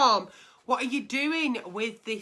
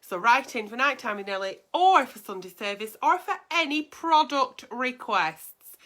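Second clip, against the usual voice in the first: ordinary delivery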